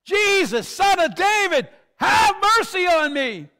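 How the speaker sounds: very high, full-force desperate yell; piercingly high, loud cry for mercy. Acted. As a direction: yell it out, stretching the final plea. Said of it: "And he yells again".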